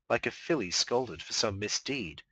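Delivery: plain reading voice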